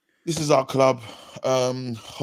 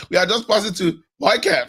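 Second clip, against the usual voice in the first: higher game-commentator call, laughing; mock FIFA commentary; 6 semitones higher, 4 dB louder than usual. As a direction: call the pass through amusement like a commentator. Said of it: "imagine on fifa".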